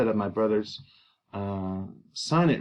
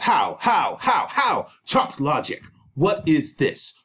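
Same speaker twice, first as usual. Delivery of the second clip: barked, rising "how"s; acted fatherly outrage; outraged father; 2 semitones higher, slower than usual. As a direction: fire off the questions in disbelief. Capulet explodes at Juliet's retort.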